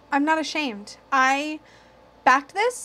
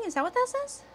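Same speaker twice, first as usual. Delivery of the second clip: much higher, puzzled double take; startled; 12 semitones higher, 6 dB quieter than usual. Real, not acted. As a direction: squeak in surprise at the words. Double-takes at a misread phrase.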